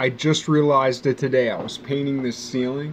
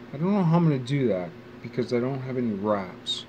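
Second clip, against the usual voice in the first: normal talking voice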